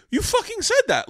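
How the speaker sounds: high, loud indignant outburst; indignant retort. Retold or imitated. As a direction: protest in outraged disbelief. Retold angry protest to dad.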